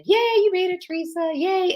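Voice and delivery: greeting Teresa as she joins, thrilled; excited greeting. Real, not acted. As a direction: squeal a happy welcome. Greeting viewer joining live.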